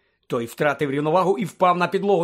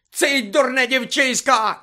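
high, loud, slow furious yell; sudden shout; 9 semitones higher, 5 dB louder, slower than usual. A narrator's reading. Snape suddenly shouting at Hermione like a madman. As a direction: explode at her like a madman. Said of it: "закричав раптом Снейп".

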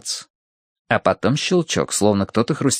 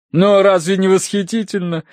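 high, delighted exclamation; восторженное восклицание; 9 semitones higher, 3 dB louder than usual. A narrator's reading. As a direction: gush as a thrilled hostess. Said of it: "воскликнула миссис Меринг". (восторженно, высоким голосом)

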